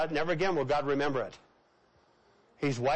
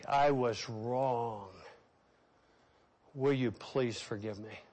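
much lower and softer, slow and heartfelt; quiet humble plea for forgiveness; lowered tender apology; 6 semitones lower, 5 dB quieter, slower than usual. Pretend. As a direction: apologize gently to the person you hurt. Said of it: "you're saying".

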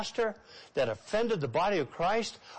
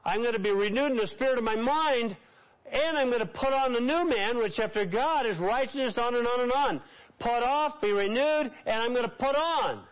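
raised, ringing pulpit declaration; fervent preacher voice; preaching at full force; 6 semitones higher than usual. Acted. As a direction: proclaim it high and strong to the congregation. Preacher declaring repentance steps.

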